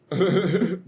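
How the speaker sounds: high, loud laugh; short burst of high laughter. Real, not acted. Reaction to own dark joke.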